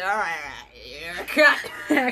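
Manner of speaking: put-on low, slow drunk voice turning to a laughing jab; mock drunk rant. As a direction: slur it like a tipsy family man. Drunk Cratchit insulting Scrooge.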